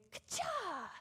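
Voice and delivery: sharp high "Ka-cha!" like a karate strike; kick sound effect. Acted. Preacher acting out defeating fear, high burst. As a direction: punch out the sound of kicking fear away. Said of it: "and say".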